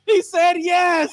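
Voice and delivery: high, slow, shouted cheer; celebrating the yes; excited cheer. Real, not acted. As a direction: shout it out in triumph. Cheering the accepted proposal.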